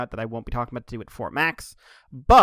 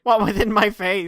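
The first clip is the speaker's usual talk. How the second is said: loud, higher-pitched stunned yell; mock bewilderment; 7 semitones higher, 6 dB louder than usual. Voicing Slugslinger after the attack, mock bewildered yell. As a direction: exclaim it as a flabbergasted cartoon villain. Imagined reaction of stunned villain.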